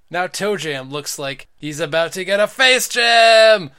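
loud, slow shouted ringside call; fighting game announcer. Acted. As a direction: hype the finishing blow. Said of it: "Stop using an announcer voice".

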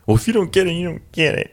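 high, mocking dismissive tone. Mocking people who shrug off feelings. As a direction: sneer out a careless brush-off. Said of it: "a lot of people are just like".

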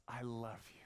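soft, whispery tender line; quiet, half-whispered love; hushed declaration. Retold or imitated. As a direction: murmur it like an intimate assurance. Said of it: "constantly yelling out to us".

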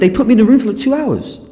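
blurting out the injustice; indignant outburst. Real, not acted. Outraged about customs detention.

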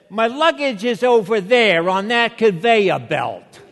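put-on fed-up shout in a Revere accent; accent came back. Fed-up traveler answers security guy, loud Revere accent. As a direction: let the Revere accent burst out, loud and high. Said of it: "so the accent came back".

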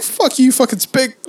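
mock coworker insult. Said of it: "they're like".

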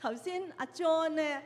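ordinary delivery

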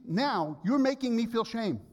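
husband retelling his irritated protest to his wife; defensive complaint. Retold or imitated. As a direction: replay your own huffy pushback. Quoting himself defensive to wife.

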